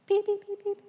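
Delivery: tiny high-pitched beeps; high little chick imitation; imitated chick peeps. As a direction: peep like baby chicks. Imitates the baby chicks' peeping.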